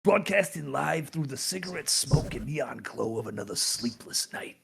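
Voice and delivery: low, gravelly-free hard-boiled radio host; noir detective. Acted. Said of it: "a detective noir voice".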